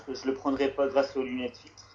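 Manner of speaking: usual speaking voice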